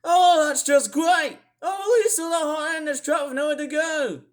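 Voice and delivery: high, loud sarcastic shout; exasperated outburst. Acted. Weather vlogger opening on cold summer, exasperated shout.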